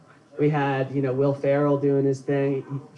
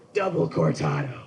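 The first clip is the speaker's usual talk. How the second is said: dramatic Jack Nicholson; 11 semitones lower than usual. Retold or imitated. Nicholson ordering coffee at Starbucks, dramatic and low. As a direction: say it like a menacing line. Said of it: "he's really dramatic about everything".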